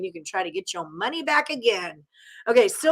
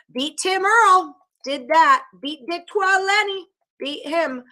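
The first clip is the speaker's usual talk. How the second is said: gloating brag; 8 semitones higher, 4 dB louder than usual. Real, not acted. Bragging about beating rivals.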